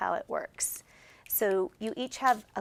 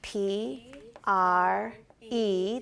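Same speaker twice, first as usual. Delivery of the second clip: very slow, stretched-out letter names; each letter held long, with gaps; spelling aloud together; 4 dB louder, much slower than usual. Shown as a demonstration. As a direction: spell it letter by letter for a class to join in. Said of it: "Let's spell it together".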